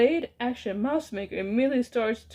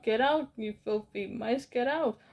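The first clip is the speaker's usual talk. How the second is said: ordinary delivery, nothing marked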